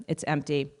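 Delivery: ordinary delivery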